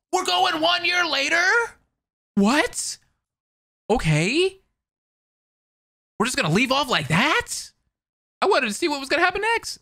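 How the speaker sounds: indignant disbelief. Real, not acted. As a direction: protest the cliffhanger. Upset at time skip.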